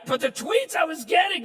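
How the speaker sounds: ordinary talk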